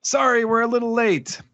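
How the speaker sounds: high-pitched heroic entrance line; arriving hero. Acted. Luffy turning up late to the battle, high-pitched announcement. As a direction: sing it out high, announcing yourself. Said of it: "then we see a familiar chest".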